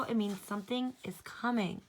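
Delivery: ordinary delivery, nothing marked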